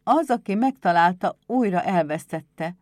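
excusing himself, firm voice slowing at the end; apologetic. A narrator's reading. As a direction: offer it as an awkward excuse. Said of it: "mentegetőzött".

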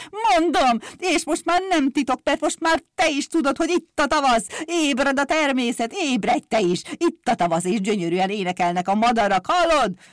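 high, loud, jubilant announcement; excited crier shouting the big news; blaring fairground barker. A narrator's reading. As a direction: proclaim it exultantly at full voice. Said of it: "harsányon harsogott, mint egy vásári kikiáltó".

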